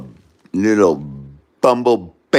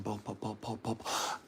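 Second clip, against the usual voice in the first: barely voiced, very soft repeated calls; whispered beckoning; hushed mock screaming; 5 semitones lower, 20 dB quieter than usual. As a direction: mime a yell with almost no voice.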